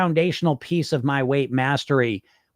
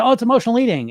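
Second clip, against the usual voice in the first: high, dismissive mock voice; mildly mocking others waving off their eating; mocking people; 8 semitones higher, 4 dB louder, faster than usual. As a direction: imitate people shrugging it off, pitched well up. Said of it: "a lot of people say".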